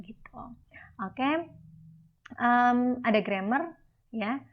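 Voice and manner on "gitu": whisper. Real, not acted. Teacher aside, whispered.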